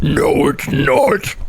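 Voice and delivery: drawn-out, slow mocking retort; mock objector. Gamers rejecting the cozy label, slow mock objection. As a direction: drag out each word as a put-on objector. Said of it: "they're gonna be like".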